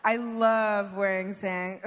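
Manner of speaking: ordinary talk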